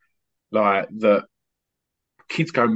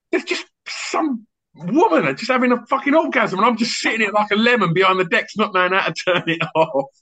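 much higher, fast, exasperated rant; mock flustered mate; 12 semitones higher, 3 dB louder than usual. DJ imitating his mate's flustered rant about the record. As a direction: rant like a panicked friend stuck at the decks. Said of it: "And he went".

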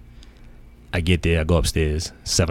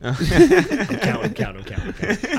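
counting aloud through laughter, pitched up high; laughing while counting; 13 semitones higher than usual. Retold or imitated. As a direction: chuckle out each count like a kid with cash. Recounting counting cash gleefully.

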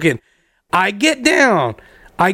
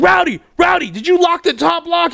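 pitched high, called through the door; locked out again, calling to roommate through the door; 9 semitones higher than usual. Retold or imitated. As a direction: holler his name to get him to answer.

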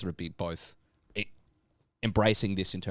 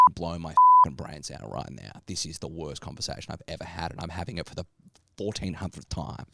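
exasperated; 6 semitones lower than usual. Retold or imitated. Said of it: "And you just go".

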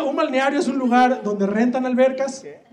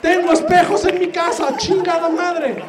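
exasperated outburst; 10 semitones higher than usual. Real, not acted. Outburst at heckler.

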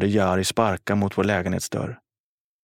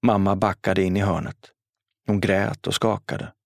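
ordinary delivery, nothing marked

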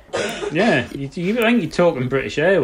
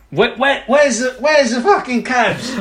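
slow, high mock Cockney whine of the hooligan; a hammed-up fake Cockney accent, dragging it out; bad movie Cockney; 8 semitones higher than usual. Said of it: "the peak of the terrible accents".

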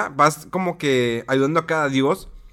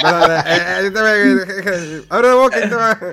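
higher, louder clowning; loud playful; 4 semitones higher, 6 dB louder than usual. Pretend. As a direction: ham it up loudly. Joking, loud, playing around. (en voz alta, payaseando)

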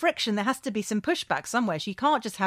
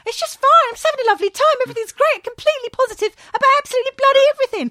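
much higher, louder, fast gushing mock cheer; bubbly imitation; 8 dB louder, faster than usual. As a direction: parody a relentlessly upbeat dancer. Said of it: "her just going".

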